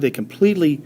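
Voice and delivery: usual speaking voice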